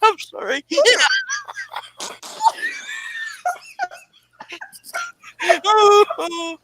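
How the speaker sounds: helpless laughing fit, tears streaming, trying to talk through it; crying with laughter. Real, not acted. Host apologizing to viewers while crying with laughter. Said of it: "no way I can stay on the lines laughing like this".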